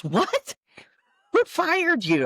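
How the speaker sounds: acted astonishment, pitch shooting up; shocked disbelief. Colleague learns the singer was fired, astonished. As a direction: react as if stunned by the news.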